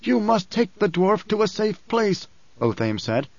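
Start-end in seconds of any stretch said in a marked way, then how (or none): 0.0-2.3 high, sick man's voice with new force; frail but suddenly insistent; odd strength. A narrator's reading. Othame urges Paul to save the dwarf, with odd strength. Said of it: "an odd strength in his voice".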